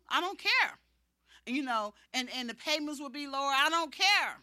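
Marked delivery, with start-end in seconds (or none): 0.1-0.8 higher, louder brush-off; firm no to the staff pastor's advice; defiant. Retold or imitated. 3.5-4.4 higher, louder brush-off; firm no to the staff pastor's advice; defiant. Retold or imitated.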